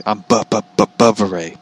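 stuttering on "Bu-, Bu-, Bu-, Bubba"; Bubba Ray. Retold or imitated. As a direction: imitate a stutter, repeating the first syllable of "Bubba". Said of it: "you know, the stuttering voice".

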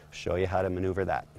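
usual speaking voice

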